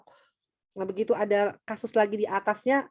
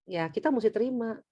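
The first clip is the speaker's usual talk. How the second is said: ordinary delivery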